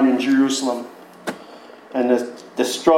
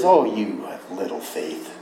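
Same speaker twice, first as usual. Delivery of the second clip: gentle rebuke; 8 semitones lower, 5 dB quieter than usual. Retold or imitated. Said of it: "the Lord reaches out and it says".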